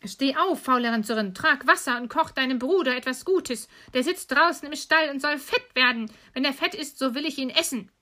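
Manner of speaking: high, scolding witch orders; calling out roughly. Acted. Witch shaking Gretel awake, scolding call. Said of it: "rüttelte sie wach und rief".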